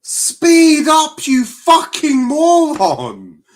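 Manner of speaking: real rage at a dawdling defender; furious shout. Real, not acted. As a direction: yell at the screen in rage. Yelling at slow player.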